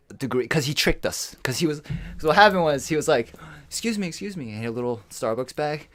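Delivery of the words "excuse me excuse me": light, higher polite ask; mock-innocent excuse me; polite stranger. Robber posing as polite stranger, light higher voice.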